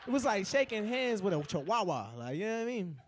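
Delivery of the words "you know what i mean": normal talking voice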